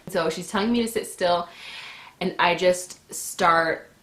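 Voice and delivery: ordinary talk, nothing marked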